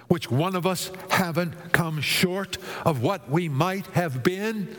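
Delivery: ordinary talk, nothing marked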